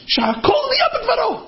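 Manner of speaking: very high, strained mock outcry; acted high-pitched proclamation; impassioned interjection. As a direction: burst in with the blessing, voice way up. Acted interrupter calls out blessing.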